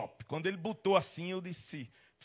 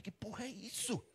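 slow, hushed, higher-pitched disbelief; baffled aside; 4 semitones higher, 12 dB quieter than usual. Acted. Man turns to his wife as tailors grab him, hushed bafflement. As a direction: mutter it slowly in mock bewilderment.

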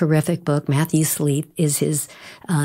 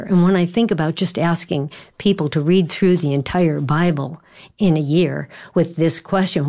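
normal talking voice

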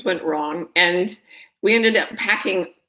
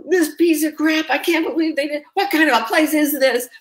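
mock outrage; 7 semitones higher than usual. Entitled plane passenger complaining about broken wifi, in high mock outrage. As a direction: whine like an entitled passenger, much higher. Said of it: "they're like".

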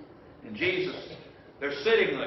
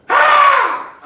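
high, loud frightened scream; scared scream; 17 dB louder than usual. Acted. Preacher voicing disciples seeing a ghost, scream. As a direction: shriek as if a ghost appeared.

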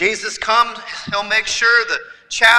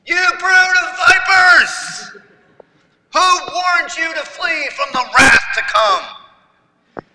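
slow, higher, loud prophetic shout; 7 semitones higher, 6 dB louder, slower than usual. Retold or imitated. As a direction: thunder it out like an angry prophet. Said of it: "he just starts yelling at them".

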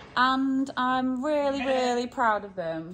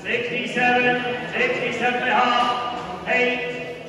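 auctioneer's rhythmic monotone chant; livestock auctioneer; 3 semitones higher, 5 dB louder than usual. Real, not acted. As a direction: call the bids in a droning, repetitive sing-song.

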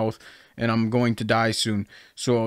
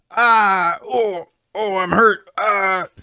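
mock hurt cries; 5 semitones higher, much slower than usual. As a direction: pretend moans of pain as a sound effect. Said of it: "just pretend you're hearing".

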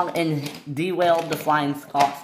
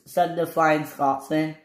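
ordinary delivery

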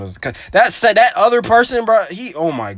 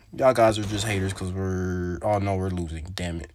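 deflated groan; 10 semitones lower, 13 dB quieter than usual. Real, not acted. Losing the match, deflating.

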